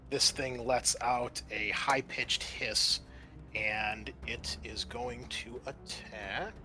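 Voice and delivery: ordinary talk, nothing marked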